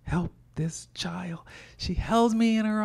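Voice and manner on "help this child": slow, soft whisper to the Lord; an aunt praying under her breath; whispered prayer. Retold or imitated. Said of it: "And she said".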